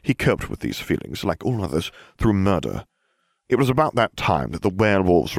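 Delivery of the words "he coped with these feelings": usual speaking voice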